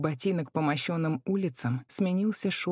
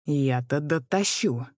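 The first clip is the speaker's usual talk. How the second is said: slow, louder scornful crone; snorting; 4 dB louder, slower than usual. A narrator's reading. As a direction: snort it back like an old crone. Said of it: "фыркнула старуха". (фыркая)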